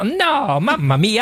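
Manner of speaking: high-pitched, hammed-up Italian dismay; mock Italian exclamation. Lottery player told the ticket won't pay, mock Italian dismay. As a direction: wail it in a cartoon Italian accent. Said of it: "you were calling Mamma Mia".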